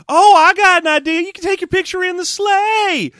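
high, squealy bright-idea voice; over-the-top high-pitched chirp. Acted. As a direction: burst out loud, then hold it very high to the end. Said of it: "a line they gave to [name]".

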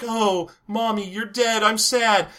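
put-on half-hearted little-girl mourning; mock sad daughter. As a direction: say it like a badly faked grieving child. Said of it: "looking at her and going like".

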